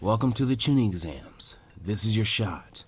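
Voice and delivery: bored, low proctor voice; laid-back man. A narrator's reading. Darui voice.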